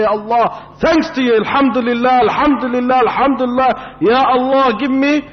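high, urgent, repeated praise; impassioned supplication, pitched high; fervent thanksgiving prayer. Acted. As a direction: implore God with mounting fervour. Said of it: "should thank Allah Azza wa Jal hundred times".

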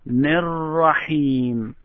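Nir-Rahim drawn out slowly, n linked into Rahim; slow recitation. Shown as a demonstration.

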